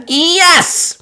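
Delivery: high excited cheer. Acted. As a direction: cheer like a happy little girl. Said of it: "cheered Peppa".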